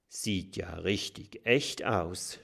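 ordinary delivery

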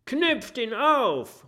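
high, slow shout from the back rows; crowd shout. Acted. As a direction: drag it out as an angry yell. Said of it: "rufen welche aus den hinteren Reihen".

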